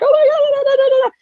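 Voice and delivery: high, loud frantic babble; imitating mom panicking on the phone; frantic panic. Daughter imitates frantic mother on the phone, high panicked babble. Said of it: "and she's freaking out".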